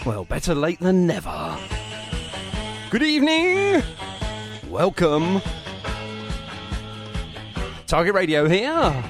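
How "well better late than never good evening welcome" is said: ordinary talk, nothing marked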